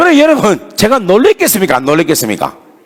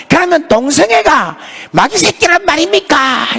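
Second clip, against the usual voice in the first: much higher mock student voice; imitating a challenging student; 10 semitones higher than usual. Student challenging the pastor's sermon, high mocking voice. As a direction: play the cheeky kid pushing back.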